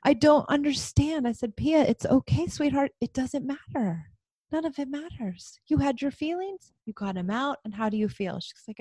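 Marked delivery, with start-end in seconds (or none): 0.0-1.3 higher-pitched imitation of a sorry child; apologetic child. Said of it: "she said". 1.5-8.4 higher, gentle comforting voice; soothing mother. Retold or imitated. Mother soothing child after tantrum.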